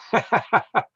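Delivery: high-pitched chuckle; short, high burst of laughter; amused laugh. Real, not acted. Laughs after naming a moderator.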